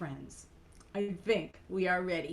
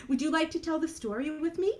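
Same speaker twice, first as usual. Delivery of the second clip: usual speaking voice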